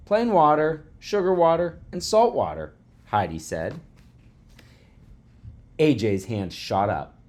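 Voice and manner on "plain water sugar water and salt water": high, loud pupil voice; schoolgirl answering. A narrator's reading. Pupil telling teacher her liquids, eager answer. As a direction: list each one loudly, pitched up.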